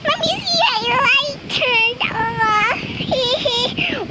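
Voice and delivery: very high, slow squeaky pretend voice; high, slow voice breaking into a laugh; baby kangaroo voice. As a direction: squeak it out like a tiny joey. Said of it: "Why does she sound like a baby kangaroo".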